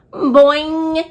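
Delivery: high twanging boing; twanged boing. Acted. Frog answering the class with a high twanging boing. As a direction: pluck it like a spring. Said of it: "he twanged".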